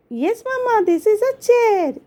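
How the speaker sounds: put-on high-pitched child voice; high little daughter voice; little girl Rini. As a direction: obedient small kid reciting to mom. Child Rini answers mom.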